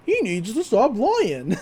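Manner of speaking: slow put-on pig voice for a ventriloquized pet; a slow, hammed-up pig puppet voice; like a girl making her pet pig talk; Waddles' pig voice. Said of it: "like, the voice, uh, she gives Waddles".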